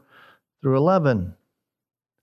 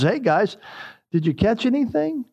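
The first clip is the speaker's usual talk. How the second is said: higher, teasing question with a smirk; sarcastic jab at the fishermen, higher pitched; 9 semitones higher than usual. Retold or imitated. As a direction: needle them with mock concern. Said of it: "You can almost hear the sarcasm".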